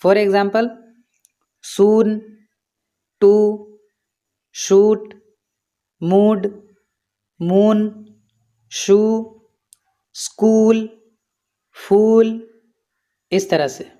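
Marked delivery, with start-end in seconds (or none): 1.6-12.5 soon, to, shoot, mood, moon, shoe, school, फूल with a held "oo"; long OO sound. Shown as a demonstration. Teacher showing learners double O, long held "oo" from soon to फूल. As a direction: draw out the "oo" in soon through फूल, word by word. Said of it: "बड़ी ऊ की तरह से pronounce करते हैं".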